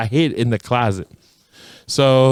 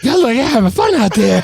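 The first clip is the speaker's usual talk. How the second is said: higher, louder, a lightly voiced envious kid; wistful kid at window; 11 semitones higher, 5 dB louder than usual. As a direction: call out longingly to the kids outside. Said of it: "staring at the top window".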